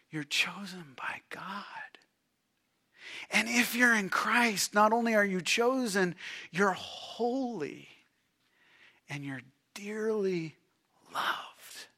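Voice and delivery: soft whisper of God's love. Acted. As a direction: breathe it to them like a secret. God's tender words to the listener.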